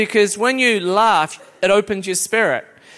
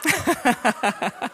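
giggle; 3 semitones higher, 6 dB quieter than usual. Real, not acted. Laughing before reading.

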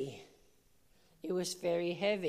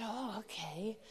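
much softer, hushed "Oh, okay"; a bewildered little answer; meek reply; 11 dB quieter, slower than usual. Retold or imitated. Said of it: "We said".

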